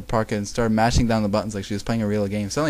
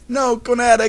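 high, loud cry of alarm; alarmed shout; screamed; 12 semitones higher, 6 dB louder than usual. A narrator's reading. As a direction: scream out for a fallen friend. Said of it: "Kagami screamed".

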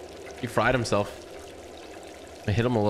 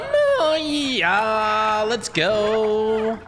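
high, drawn-out celebratory cheer; triumphant whoop; 9 semitones higher, 4 dB louder, much slower than usual. Real, not acted. Player celebrating a little juke, triumphant whoop. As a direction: whoop high and hold each word.

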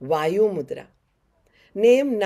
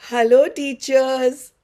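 excited greeting; 7 semitones higher, 9 dB louder, slower than usual. Real, not acted.

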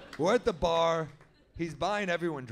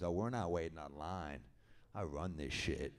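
much lower and softer, a local tough guy; Stu's voice; 10 semitones lower, 8 dB quieter than usual. Retold or imitated. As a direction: drop way down into Stu's low voice. Stu voiced, cocky.

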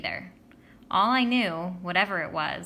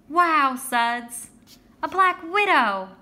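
put-on loud, high crowd of amazed kids; excited kids; 5 semitones higher, 8 dB louder, much slower than usual. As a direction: cheer the hero of the schoolyard. Kids mobbing him at recess.

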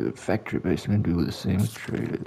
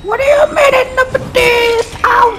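shrieking high tearful yell; crying shout; 11 dB louder than usual. Real, not acted. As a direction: shriek in outraged panic.

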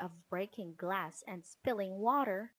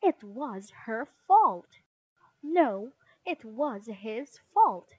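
louder squabble, punched at the ends; moderately raised accusing cry; shouted blame; 2 semitones higher, 9 dB louder than usual. Acted. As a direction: shout it like bickering siblings. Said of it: "pointing at each other and shouting".

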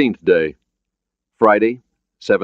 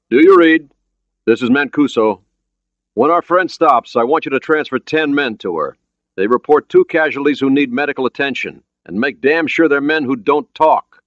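raised, higher commanding voice; radio command; 9 semitones higher, 4 dB louder than usual. Acted. Skipper ordering men transferred by radio, raised commanding voice. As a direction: issue firm orders into a radio.

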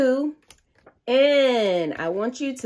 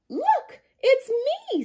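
Susie's line, much higher and bright; excited Susie; 4 dB louder than usual. A narrator's reading. Storyteller voicing Susie showing her baby photo, high and excited. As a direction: pipe it up high like a small girl. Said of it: "says Susie".

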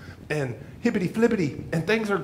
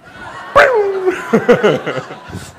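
high laugh; 14 semitones higher, 6 dB louder than usual. Real, not acted. Laughs at his own punchline.

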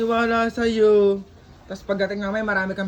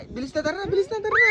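urgent hurrying; 7 semitones higher, faster than usual. Real, not acted. Vlogger hurries the child along to town, rising urgency. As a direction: pitch climbing steeply to a high urging cry.

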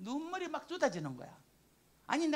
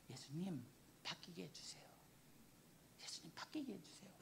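soft, much quieter pleading prayer; hushed prayer; 6 semitones lower, 16 dB quieter than usual. Retold or imitated. She prays for her husband to change, hushed. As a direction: murmur the plea to Jesus softly.

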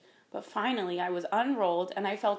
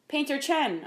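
raised-pitch admiring address; impressed, higher-pitched wonder; great awe; 7 semitones higher than usual. A narrator's reading. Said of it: "said in great awe".

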